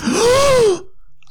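high, drawn-out gasp; mock shocked gasp. Co-host hearing the dig at Victorians, mock shocked gasp. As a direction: gasp as if scandalised.

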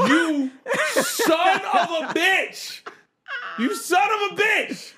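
high, drawn-out comic wail; hammed-up howl of betrayal; mock outraged cry. Retold outraged reaction to bully's remark.

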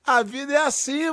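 resigned irony, stretched out; ironic resignation. Real, not acted. Repete com ironia. (resignação irônica)

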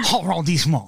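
hammed-up French on "Arrondissement"; mock French accent. Host parodying the French for the guest, mock French accent. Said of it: "You sound exactly like that".

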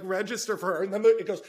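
ordinary talk, nothing marked

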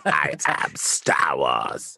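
very slow, drawn-out villain voice; mock Sith lord, stretching every word; Emperor. Co-host teasing a bad impression, laughing Emperor voice. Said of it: "I thought you were doing the Emperor".

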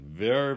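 very said loud and rising; stress on very. Real, not acted. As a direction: lean hard on very. Stressing Zelensky's dramatic visit.

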